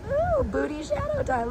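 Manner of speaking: very high cooing falsetto; squeaky teasing coo. Pretend. Cooing about her booty shadow.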